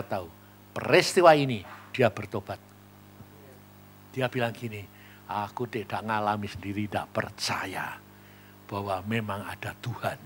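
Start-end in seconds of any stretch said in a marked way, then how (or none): 5.3-10.2 breathy whisper, lower; whispered confession. Retold or imitated. Convert confessing his doubt about God, whisper. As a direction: hush it like a secret.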